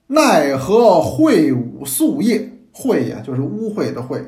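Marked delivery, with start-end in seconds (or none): 0.1-2.5 slow, measured reading of ancient text; classical recitation. Shown as a demonstration. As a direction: recite the original line slowly. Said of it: "原文写：".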